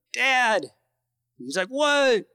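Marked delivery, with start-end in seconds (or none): 0.1-0.7 high, drawn-out call to dad; retold yell. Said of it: "I yelled up to him". 1.7-2.2 high-pitched, drawn-out imitated call; calling back. Dad calling down from cliff.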